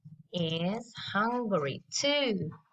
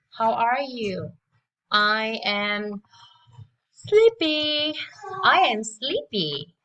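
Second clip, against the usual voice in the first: loud drill; 2 semitones higher, 6 dB louder than usual. Shown as a demonstration. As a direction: belt it out for kids to repeat. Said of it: "let's listen and repeat after me".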